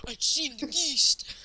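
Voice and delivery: high, slow, drawn-out mock cry; mock your friend's amazed reaction, high and stretched; exaggerated high-pitched imitation; mimicking friend's reaction. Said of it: "best reaction in the world".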